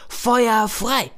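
high, loud command to fire; captain orders the cannons, high and loud; battle cry. Acted. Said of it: "rief der Kapitän".

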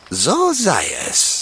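put-on slow, ominous old crone murmur; muttering old woman. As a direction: mutter it like a witch.

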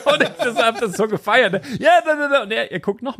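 high, laughing storytelling; laughing while telling. Real, not acted. Telling friends about the bouncer, laughing hard. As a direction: laugh through the words. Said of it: "ich musste so lachen".

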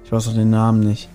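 ordinary talk, nothing marked

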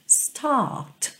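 "start" with a crisp British T and no R; soft, clipped British "start". Shown as a demonstration. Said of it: "Com uma pronúncia mais britânica".

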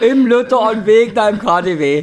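high and loud through laughter; laughing mockery of the answer. Real, not acted. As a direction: blurt it out cracking up.

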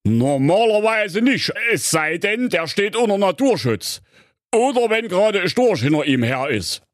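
know-it-all fairy-tale father; pondering father. Acted. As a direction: consult the rulebook, stretching the first words. Voices the father.

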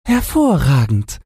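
high piglet voice; tiny high-pitched pig character; little pig. A narrator's reading. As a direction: answer in a squeaky pig voice. Little pig answers the wolf.

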